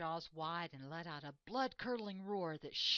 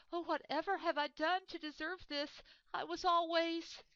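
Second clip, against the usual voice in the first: a much higher, louder, wounded wail; hammed-up moan; 12 semitones higher, 8 dB louder than usual. Dethroned lion moans at the rebelling animals, hammed-up. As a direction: play the hurt king over the top. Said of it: "clutched at his heart and moaned".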